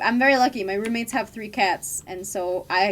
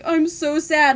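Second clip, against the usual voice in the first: mock sad dog; 6 semitones higher, 7 dB louder than usual. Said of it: "And he's like".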